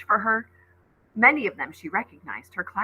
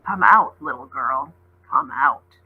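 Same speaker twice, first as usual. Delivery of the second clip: a growled call, loud on the first out; growling beast; 6 semitones lower, 5 dB louder than usual. Acted. Beast growling at the hiding girl, luring her out. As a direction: coax her out with a low growl. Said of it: "it growled again".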